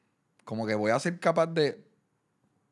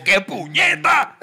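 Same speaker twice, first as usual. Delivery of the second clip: high, loud, drawn-out exasperation; imitating mom's outburst; 12 semitones higher, 10 dB louder, slower than usual. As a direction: blurt it like an exasperated mother. Voz de mamá regañando al hijo. (estallido imitado de mamá)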